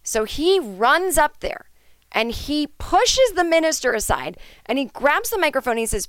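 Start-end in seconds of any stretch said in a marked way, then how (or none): none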